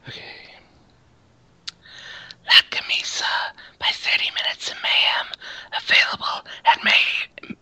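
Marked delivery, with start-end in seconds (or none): 2.5-7.6 breathy sultry ad whisper. Acted. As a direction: breathe it out like a perfume ad. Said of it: "do your best to sound like a female".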